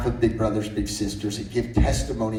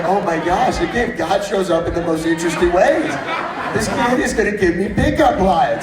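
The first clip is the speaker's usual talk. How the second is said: higher and louder, words bursting with laughter; can't believe the kid's pickup line, cracking up; laughing disbelief; 6 semitones higher, 6 dB louder than usual. Retold or imitated. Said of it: "And I was like".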